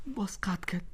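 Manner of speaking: whispered in frustration; muttered whisper. Acted. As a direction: breathe it out, defeated. Wife hung up again. (زمزمهٔ ناامید)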